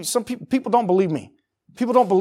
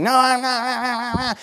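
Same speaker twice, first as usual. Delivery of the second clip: high, drawn-out mock toddler whine; hammed-up kid tantrum, high and slow; whiny refusing child; 5 semitones higher, 3 dB quieter, much slower than usual. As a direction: stretch it into a child's wailing protest. Acts out child refusing parent.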